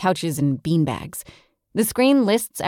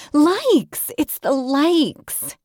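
excited; 9 semitones higher, 4 dB louder, much slower than usual. Acted. Claire discovers the likes.

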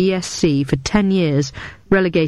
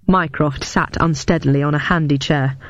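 usual speaking voice

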